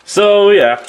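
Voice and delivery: excited. Acted. Big wrap-up opener.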